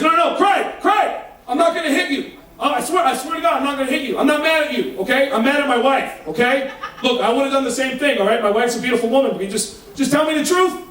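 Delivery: high-pitched, laughing plea of a husband; a husband swearing he won't hit anyone; pleading husband. Retold or imitated. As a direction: beg him to believe you, high and frantic.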